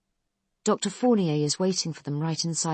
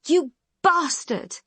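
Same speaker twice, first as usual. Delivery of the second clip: much higher, louder, slow screamed insult; furious shout; 11 semitones higher, 5 dB louder, slower than usual. A narrator's reading. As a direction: hurl it at him in rage. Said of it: "Khan shouts".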